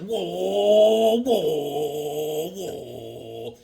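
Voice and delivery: long drawn-out whoas, fading away; sway through three long whoas as if flying off balance; sing-song whoa, sinking and trailing off; wobbly flying whoa. Pretend. Voices figure struggling in flight.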